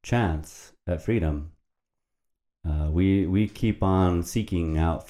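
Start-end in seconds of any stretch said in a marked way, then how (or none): 0.0-1.5 slow solemn emphasis. Real, not acted.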